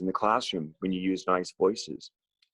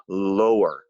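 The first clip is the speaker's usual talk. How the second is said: deep, loud demonstration word; lower voice; 4 semitones lower, 8 dB louder than usual. Shown as a demonstration. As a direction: drop it low and push it out. Demonstrates what lower means.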